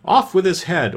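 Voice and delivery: high, mildly shouted command; understated imperious shout. A narrator's reading. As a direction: call out the sentence like a queen. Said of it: "and shouting".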